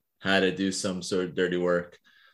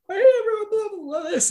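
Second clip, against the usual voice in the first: put-on high, raspy old man griping; Herbert voice; 3 dB louder, faster than usual. Veteran patient complaining to his therapist, raspy high old man voice. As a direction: complain like a cranky old codger.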